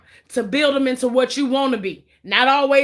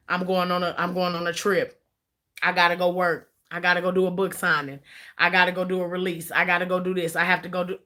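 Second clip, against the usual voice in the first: lower, softer list of excuses; husband's low excuses; 5 semitones lower, 4 dB quieter than usual. Retold or imitated. Cheating husband giving his wife excuses to leave, low voice.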